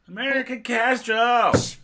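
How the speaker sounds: high, slow, loud drawn-out bird name; mock exaggerated. Co-host jokingly repeating a bird name, high and loud.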